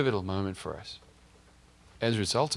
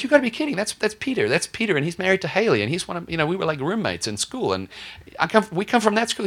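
amazed recognition; 10 semitones higher, 6 dB louder than usual. Retold or imitated. Recognizing friend in photo.

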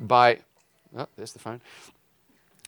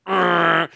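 loud growling truck blare; imitated truck horn; 5 semitones higher, 7 dB louder than usual. Tailgating trucker answers the driver's protest, loud imitated horn blare. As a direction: blast the horn in mimicry. Imitates eighteen-wheeler bearing down.

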